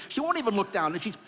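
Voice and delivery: ordinary delivery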